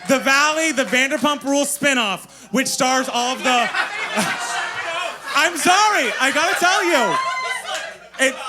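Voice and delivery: loud, giggly gushing about the show; can't hold back the laughter while raving; laughing excitement. Real, not acted.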